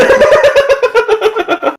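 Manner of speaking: long burst of high laughter. Real, not acted. Player cracking up at the tail suggestion, real laughter. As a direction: laugh hard and high at the idea.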